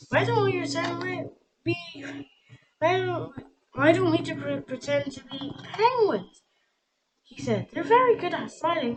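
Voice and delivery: high, loud, eager pitch; excited idea. A narrator's reading. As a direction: burst out with the plan.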